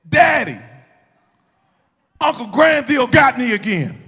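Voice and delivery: high, loud holler for help; yelling for daddy. Retold or imitated. Nephew caught by his uncle calls to his father, yelling. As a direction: yell out like a kid calling for rescue. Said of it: "is just yell".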